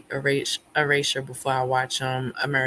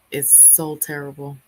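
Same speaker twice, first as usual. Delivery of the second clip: ordinary delivery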